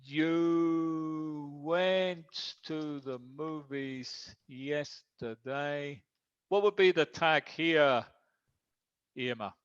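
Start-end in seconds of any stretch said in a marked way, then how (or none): 0.0-6.0 very slow, each word drawn out; slow dictation. Shown as a demonstration. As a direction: stretch every word out for a learner writing it down. Teacher dictates the example slowly.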